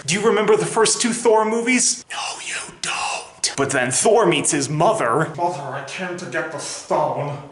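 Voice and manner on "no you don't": whispered aside. Real, not acted.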